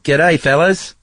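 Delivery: put-on light Australian accent on a greeting; a quick Aussie "G'day" to the fellas; Australian twang, lightly done. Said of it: "That's pretty good".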